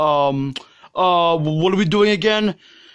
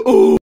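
trademark oh; 7 dB louder than usual. Shown as a demonstration. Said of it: "my trademark noise".

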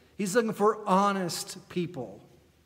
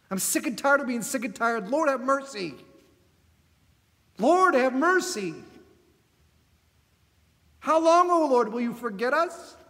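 raised, pleading cry to God; pleading lament; 6 semitones higher, 5 dB louder than usual. Retold or imitated. Congregation lamenting before the Lord, pleading loud. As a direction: pour out your complaint, loud and urgent. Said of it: "We say".

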